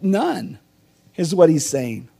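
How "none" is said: ordinary talk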